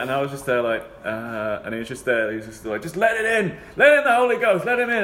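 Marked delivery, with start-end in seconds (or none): 2.8-5.0 high, louder urging preacher; hammed-up preacher urging; mock preacher. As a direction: urge it high and loud. Imitating preacher.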